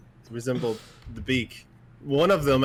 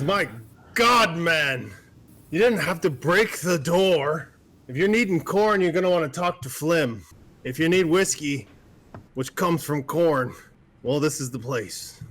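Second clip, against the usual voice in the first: raised, higher exasperated barkeep; a lightly voiced bartender, fed up about the door; 4 semitones higher, 3 dB louder than usual.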